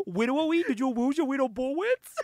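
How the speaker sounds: baby-talk the words to mock. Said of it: "He called them bullets".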